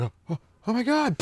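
high, loud mock panic. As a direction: yelp out in fake alarm as it starts. Smoker's dick about to explode.

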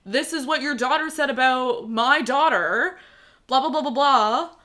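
hammed-up gloating quote; mock smug aunt. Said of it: "It was like".

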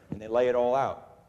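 ordinary talk, nothing marked

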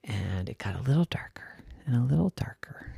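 soft, quick, half-whispered suspense; hushed narration. A narrator's reading. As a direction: drop into a near whisper. Narrating the cave growing darker.

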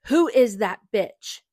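furious, drawn-out scream; loud, slow screamed accusation. Acted.

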